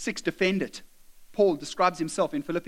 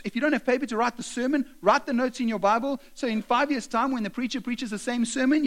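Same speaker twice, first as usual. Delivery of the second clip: ordinary talk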